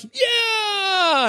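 high cheering shout; team cheer. Retold or imitated. Girls answering their coach in the huddle, a cheering shout. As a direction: yell it like a fired-up team. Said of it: "And they're like".

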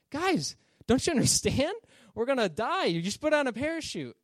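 high, loud, incredulous appeal; urgent pleading. Acted. Passenger to laughing people on a doomed plane, urgent pleading. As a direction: plead with them as if they're missing the obvious.

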